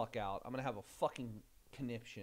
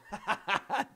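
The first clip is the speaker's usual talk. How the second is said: loud laugh; 7 semitones higher, 7 dB louder than usual. Real, not acted. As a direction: burst of real laughter, higher and louder. Laughs at chat joke.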